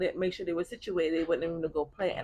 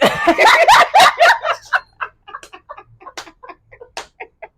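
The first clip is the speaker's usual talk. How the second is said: long, high, loud howl of laughter; 15 dB louder than usual. Real, not acted. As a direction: let a huge laugh roll on.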